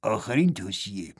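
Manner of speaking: a dignified hushed whisper; grave whisper. A narrator's reading. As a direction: lean in and breathe the warning. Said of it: "با صدایی که نشانی از وقار". (با نجوای باوقار و آرام)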